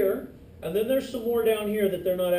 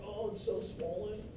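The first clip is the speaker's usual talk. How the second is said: mock sick complaint; 9 dB quieter than usual. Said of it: "you're like".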